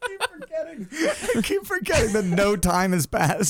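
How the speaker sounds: laughing as he speaks; amused, still laughing. Real, not acted. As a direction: chuckle through the line. Laughing at own confusion.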